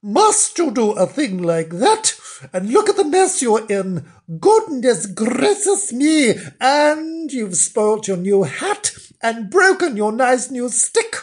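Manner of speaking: high-pitched wifely dismay; appalled, high storybook wife; surprised scolding. Acted. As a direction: fuss in horror over the mess. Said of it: "said Missus Flange in surprise".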